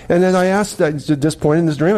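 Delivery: ordinary talk, nothing marked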